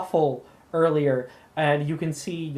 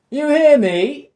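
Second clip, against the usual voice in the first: high, loud, slow call; 12 semitones higher, 11 dB louder than usual. Acted. Day drinker calls Camille over, loud and high. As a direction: yell across the street at her.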